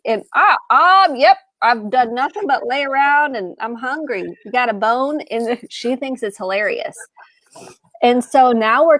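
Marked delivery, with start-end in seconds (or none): 0.4-5.2 high, goofy talking dog voice; cartoon dog. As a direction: play the lazy, hungry family dog. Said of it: "I'll pretend like I'm the dog and I'll speak for the dog".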